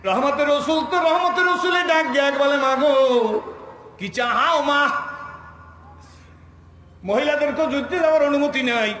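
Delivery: high, loud chanted sermon; full-force preaching. Acted. Preacher relaying the refusal of battle permission, full-force chant. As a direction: cry out the story in a ringing chant.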